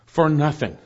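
"for nothing" set apart after a pause; deliberate, separated emphasis on "for nothing"; emphatic. Shown as a demonstration. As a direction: land firmly on "for nothing". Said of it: "it's emphatic".

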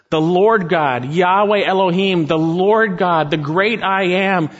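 usual speaking voice

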